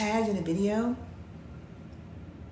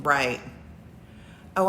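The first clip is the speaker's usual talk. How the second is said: ordinary talk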